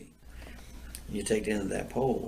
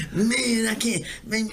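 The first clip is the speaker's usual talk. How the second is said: imitating scared buddy; 13 semitones higher, 3 dB louder, slower than usual.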